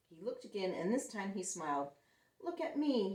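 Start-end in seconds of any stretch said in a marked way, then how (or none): 2.4-3.1 high, eager cry; excited cry. Acted. Splat showing off sock legs, excited cry. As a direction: show off the costume proudly. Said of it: "he cried".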